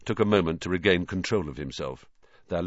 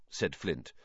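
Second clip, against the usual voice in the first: ordinary delivery, nothing marked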